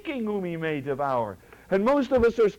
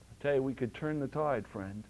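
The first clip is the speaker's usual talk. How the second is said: normal talking voice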